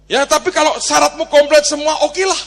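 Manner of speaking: lightly voiced official; official's reply. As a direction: act the official grudgingly agreeing. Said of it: "tapi dia ngomong begini".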